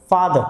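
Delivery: फादर ending on an open schwa; silent final R. Shown as a demonstration. Teacher demonstrates for learners, फादर with silent R. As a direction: leave the R off the end of फादर. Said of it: "आर को हम silent रखेंगे".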